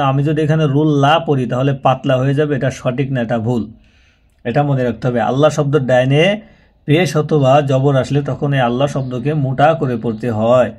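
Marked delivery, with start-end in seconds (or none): none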